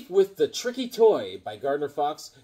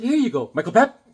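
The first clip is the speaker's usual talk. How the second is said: higher, louder name call rising at the end; teasing offer; 6 semitones higher, 6 dB louder than usual. Retold or imitated. Handing a touchy coworker candy, high teasing call. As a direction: hand it over in a mock-chirpy call. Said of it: "I said".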